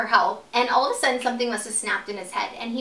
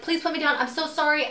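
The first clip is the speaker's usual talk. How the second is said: higher-pitched pleading, acted out; retold panicked apology; 5 semitones higher than usual. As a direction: beg to be let down, imitating panic. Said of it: "I'm, like, apologizing to him".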